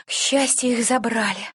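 plain reading voice

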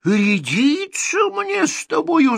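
very high, loud outraged tsar; furious tsar. Acted. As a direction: roar it like an offended king. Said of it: "закричал он".

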